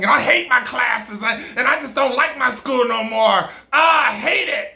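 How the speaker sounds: high, loud venting; frustrated rant. Acted. Woman complains to him about her school, venting loudly.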